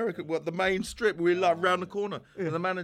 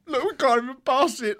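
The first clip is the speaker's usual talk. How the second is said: giggling; 10 semitones higher, 9 dB louder than usual. Retold or imitated. Giggling at the back of training.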